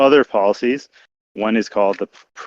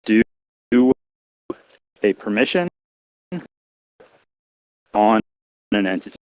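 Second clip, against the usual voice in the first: breaking up. Real, not acted. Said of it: "You're breaking up, [name]".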